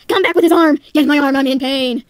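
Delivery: acted pained shouting; calling out in pain. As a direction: yell for them to come back. Yelling after the arm thief.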